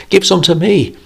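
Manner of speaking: put-on children crying out for coins; excited children. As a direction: squeal like eager kids. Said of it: "they cried".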